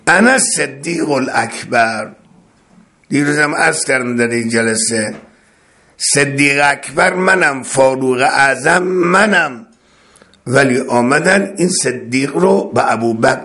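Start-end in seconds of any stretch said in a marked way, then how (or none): none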